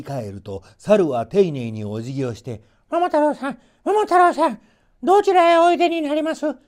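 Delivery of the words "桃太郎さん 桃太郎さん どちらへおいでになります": high, loud chattering monkey; an eager monkey calling up to Momotaro; shrill-voiced excited monkey. A narrator's reading. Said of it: "と尋ねました".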